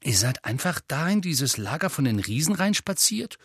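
amazed schoolboy asking; awed disbelief. Acted. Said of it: "mit ehrfürchtiger Miene". (staunend, höher)

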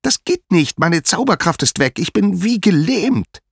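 very high-pitched, insistent despair; acted high voice of panic; desperate wail. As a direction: wail it as a helpless trapped goddess. Trapped Hera answers in despair.

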